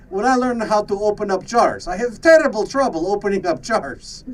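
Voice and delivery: put-on a touch of a comic Russian accent. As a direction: play the doctor's Russian accent, lifted and lively. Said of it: "The accent that I'm doing".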